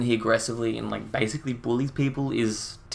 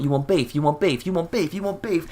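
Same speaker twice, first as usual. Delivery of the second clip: mock rant climbing into high-pitched aggression; aggressive; 8 semitones higher than usual. Commentator imitating prankster demanding knees, increasingly aggressive. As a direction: build from pushy repeats to a high, frantic demand. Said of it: "He aggressively goes up to people".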